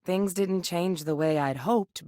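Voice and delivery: ordinary talk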